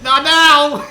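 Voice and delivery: frustrated shout. Real, not acted. Yelling again mid-boss.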